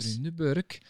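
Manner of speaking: Thunberg again, Swedish TOO-nbook sound; Swedish accent. Real, not acted. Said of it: "You say that with a Swedish accent".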